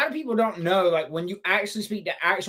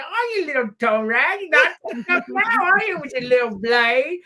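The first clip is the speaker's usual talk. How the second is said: lightly imitated taunting old lady, pitched up; mock name-calling; 6 semitones higher than usual. Retelling the old lady's taunts to co-hosts, mock scolding. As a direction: tease in a higher put-on voice. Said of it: "calling you names".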